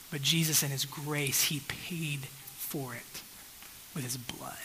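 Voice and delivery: near-whisper, slowing right down; hushed reverence. Real, not acted. Preacher telling congregation how the debt was paid, hushed. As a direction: let it sink to a slow, solemn whisper. Hushed climax of gospel point.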